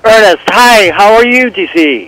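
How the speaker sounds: loud, high cheerful greeting; too loud. Real, not acted. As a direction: greet him much too loudly. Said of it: "you can't be so loud".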